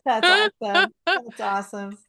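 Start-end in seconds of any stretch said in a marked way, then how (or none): 0.0-0.9 much higher and louder, thrilled; delighted. Real, not acted. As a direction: burst out with delight. Host reacts to sweet story.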